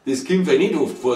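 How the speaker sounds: usual speaking voice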